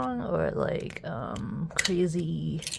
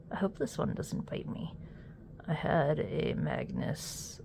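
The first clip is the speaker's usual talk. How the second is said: hushed ASMR whisper, close and soft; 6 dB quieter than usual. Real, not acted. Whispering to viewers while testing the keyboard. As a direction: breathe it like an unboxing whisper.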